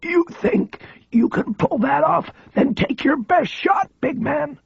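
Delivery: high, slow, defiant taunt of a frail man; frail high voice, daring; coming back strong. A narrator's reading. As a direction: dare him to try it. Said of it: "came back strong".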